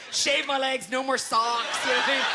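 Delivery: usual speaking voice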